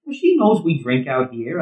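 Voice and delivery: ordinary talk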